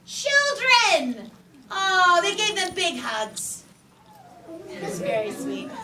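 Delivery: ordinary delivery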